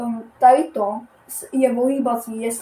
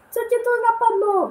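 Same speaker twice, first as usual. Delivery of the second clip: mock squeaky voice; 12 semitones higher, faster than usual. Mocks himself in a squeaky voice.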